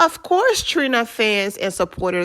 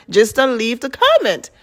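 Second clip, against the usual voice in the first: ordinary talk, nothing marked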